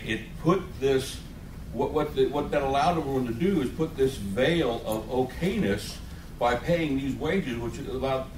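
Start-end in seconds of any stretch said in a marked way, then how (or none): none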